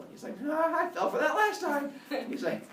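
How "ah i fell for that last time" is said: high-pitched comic objection; wary protest. Acted. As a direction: protest high, refusing to be fooled. Father Sky protests, was fooled.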